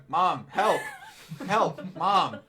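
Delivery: matter-of-fact mock call for help; imitated calm kid calling. Kid at a burger counter calls Mom, imitated calm calling. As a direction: repeat the call like an unbothered child. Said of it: "in a very calm voice".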